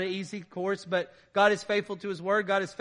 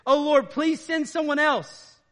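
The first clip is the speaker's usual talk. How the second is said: high, loud, slow begging; pleading Moses; 8 semitones higher, 8 dB louder, slower than usual. Acted. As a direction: beg to be let off the job. Moses begs to be excused.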